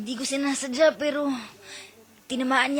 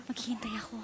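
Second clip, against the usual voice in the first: soft, hushed lover's farewell; mock tender whisper; 9 semitones lower, 6 dB quieter than usual. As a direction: breathe it like a parting lover. Mock romantic farewell role-play.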